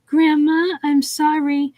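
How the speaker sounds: a hint of a small boy apologizing; young boy. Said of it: "the voice of a young boy".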